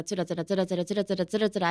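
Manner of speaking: rapid, even run of "tsura-tsura"; imitated droning chatter. Speaker mimics the other person talking at length, rapid chatter. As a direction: rattle it off like someone talking on and on. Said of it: "相手が話してます".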